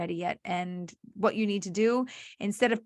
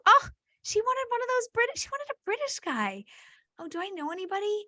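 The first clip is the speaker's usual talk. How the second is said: high, airy musing about British guys, much higher than her own voice; mock matchmaker thinking aloud; 11 semitones higher than usual. Matchmaker acting out her own reaction to a client's request, high mock musing. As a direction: play the matchmaker recalling a client.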